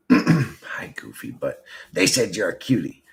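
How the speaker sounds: usual speaking voice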